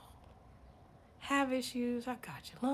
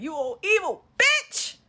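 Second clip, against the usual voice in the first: loud, high, drawn-out accusation; slow, pitched-up yell climbing on the last word; shouted insult; 7 semitones higher, 9 dB louder, slower than usual. Acted. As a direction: cry it out as if appalled by a villain. Acting others reacting to Cynthia.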